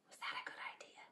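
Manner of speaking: hushed worried aside; whispered aside. Real, not acted. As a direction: lean in and whisper the worry. Storyteller aside to children.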